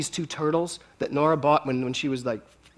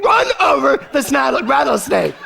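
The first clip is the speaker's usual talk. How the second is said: high, loud laughing insistence; laughing shout; 8 semitones higher, 8 dB louder than usual. Retold or imitated. Urging Kevin to run over the snake, laughing shout. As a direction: push the joke through laughter.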